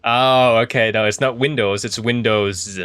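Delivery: ordinary talk, nothing marked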